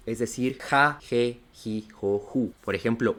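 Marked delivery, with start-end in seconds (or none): 0.6-2.5 "ja, je, ji, jo, ju" with an aspirated Spanish J. Shown as a demonstration. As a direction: list "ja, je, ji, jo, ju" syllable by syllable, each starting with a J sound. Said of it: "La H suena como una J".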